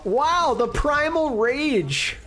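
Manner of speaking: high-pitched, drawn-out amazement; amazed excitement. Real, not acted. Caster reacting to the Primal Rage, excited awe.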